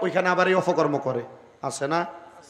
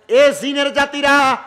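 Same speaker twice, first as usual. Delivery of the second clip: high, loud commanding call; angry summons; 5 semitones higher, 5 dB louder than usual. Acted. Angered prophet summons the jinn, high loud call. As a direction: summon them with a raised voice. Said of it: "এবার রাগান্বিত হয়ে গেলেন, ডাক দিলেন".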